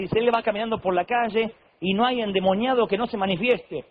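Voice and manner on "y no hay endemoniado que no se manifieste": ordinary talk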